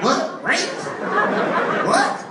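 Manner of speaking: stunned, can't believe what he heard; rising outraged what; shocked disbelief. Acted. Dave hears the shocking news.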